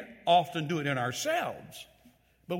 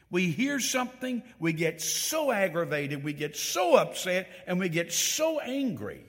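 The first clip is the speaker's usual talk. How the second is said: higher and louder, building with each so; preaching at full force; 6 semitones higher, 4 dB louder than usual. Acted. Preacher rebuking the congregation's quick anger, rising and loud. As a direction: press the point home, rising.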